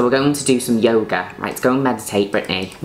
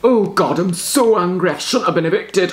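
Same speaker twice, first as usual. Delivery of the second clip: much higher put-on angry voice; 10 semitones higher than usual. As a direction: squeal it like an evicted star in a strop. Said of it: "my name's [name]".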